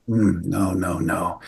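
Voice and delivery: low, soft doomful murmur; grim anesthesiologist. Retold or imitated. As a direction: shake your head through low, hushed refusals. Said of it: "he's got this voice".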